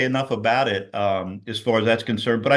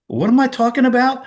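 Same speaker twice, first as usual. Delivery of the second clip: much higher and louder puzzled quote; confused self-question; 13 semitones higher, 5 dB louder than usual. Retold or imitated. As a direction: sound lost mid-sentence. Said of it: "I'd say".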